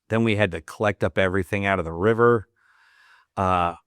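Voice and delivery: ordinary talk, nothing marked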